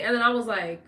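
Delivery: ordinary talk, nothing marked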